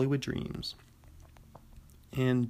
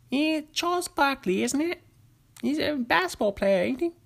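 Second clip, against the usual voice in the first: much higher, hammed-up British accent; bad British accent; 13 semitones higher than usual. Joking about Charles Barkley in a bad British accent. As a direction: slip back into the terrible British impression. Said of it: "Terrible, terrible accents".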